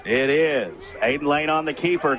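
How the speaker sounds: ordinary talk, nothing marked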